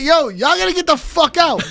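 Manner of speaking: high frantic demand; high mock-panicked get out; exasperated. Telling crew to leave.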